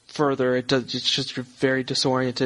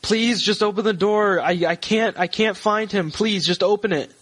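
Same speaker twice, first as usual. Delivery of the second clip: high, urgent pleading; distressed woman; 7 semitones higher than usual. Acted. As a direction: beg desperately in a higher woman's voice. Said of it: "she seems distressed".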